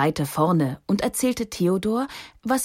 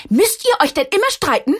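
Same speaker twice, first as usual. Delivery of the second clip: much higher and louder, sharp order; resolute shout; 10 semitones higher, 6 dB louder, faster than usual. A narrator's reading. Tante Elli shouts down at the quarrelling stones, resolute. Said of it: "eine resolute Stimme".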